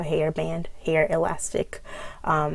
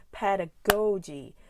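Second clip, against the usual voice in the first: pedagogy slowly, GO long and punched; emphatic pedagogy, heavy on GO; stress on GO. Shown as a demonstration. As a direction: stretch GO in PED-a-GO-gy. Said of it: "um, the stress".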